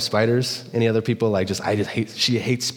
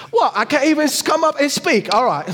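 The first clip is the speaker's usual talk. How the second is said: much higher, hammed-up comic squawk; silly sketch-comedy outrage, pitched way up; Monty Python skit; 3 dB louder than usual. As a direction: play it like an indignant Monty Python character. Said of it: "it turned into a Monty Python skit".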